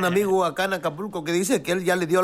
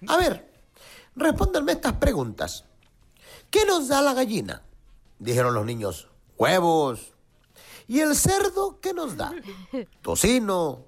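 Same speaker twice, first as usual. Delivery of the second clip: much higher, slow quizzing teacher; teacher's voice; 7 semitones higher than usual. Acted. Teacher quizzing the schoolchildren in a high voice. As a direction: quiz them like a bossy schoolteacher.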